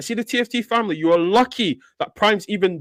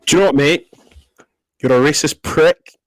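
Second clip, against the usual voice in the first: loud, low, furious scolding; angry telling-off; 4 semitones lower, 5 dB louder than usual. Real, not acted. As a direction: tear into him with open contempt. Angry confrontation of racist streamer.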